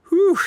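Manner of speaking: loud, high breath of relief; relieved exhale. Acted. Knight exhaling loudly in relief after the fight. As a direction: blow it out after the danger passes.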